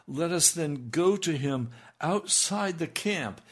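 loud, high preaching voice. Acted. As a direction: proclaim the verse with fire. Reading scripture with force.

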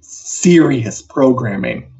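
usual speaking voice

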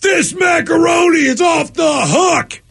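high, drawn-out holler; party-guest yell. Acted. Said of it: "with a plate of food and yells".

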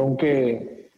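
ordinary talk

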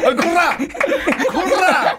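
high joking yell of こら; playful telling off; mock scolding. Mock scolding partner's silly remark.

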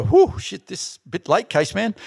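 high startled "whew", then alarmed; startled yelp. Retold or imitated. Surfer retelling a late wave to friends, startled high yelp.

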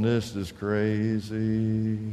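low, slow drawn-out grumble; grumbling disbelief. Acted. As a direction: drag it out low, like a reluctant soldier. Soldier grumbles while walking round walls.

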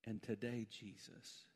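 low, soft praying with long pauses; hushed prayer. Real, not acted. Pastor asking God to lift somebody up, hushed prayer. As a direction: pray gently and unhurriedly, voice dropped.

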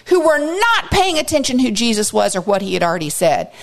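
ordinary talk, nothing marked

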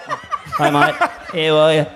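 hammed-up little boyfriend; imitating boyfriend. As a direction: chirp it like a tiny bloke. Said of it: "He was like".

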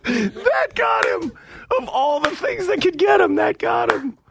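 gasping through laughter; laughing. Real, not acted. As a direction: squeal it out mid-laugh. Host laughing hard.

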